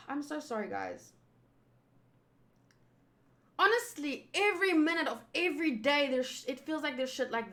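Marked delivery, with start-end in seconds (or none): 0.1-1.1 soft, sighing and much quieter; deflated apology. Real, not acted. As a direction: let the air out, defeated. Sighing apology after rant.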